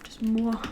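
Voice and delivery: awkward failed evil cackle; awkward laugh. Shown as a demonstration. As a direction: attempt an evil laugh that fizzles. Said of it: "doing an awkward laugh".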